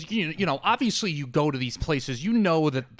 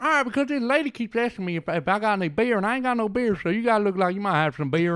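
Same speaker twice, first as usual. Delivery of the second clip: put-on higher, rambling Southern country accent; mock Southern accent; 7 semitones higher, faster than usual. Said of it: "that is literally how they talk".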